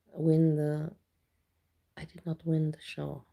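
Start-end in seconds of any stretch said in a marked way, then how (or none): 2.0-3.2 whispered admission. Real, not acted. Admits losing show softly.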